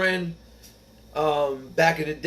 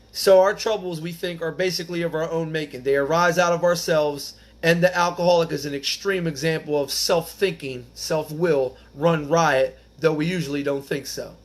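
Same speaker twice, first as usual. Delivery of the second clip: raised, deliberate reading of the book; reading aloud; 4 semitones higher, 4 dB louder than usual. Acted. Reading the passage to the meeting, raised and deliberate. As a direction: lean on each phrase of the text.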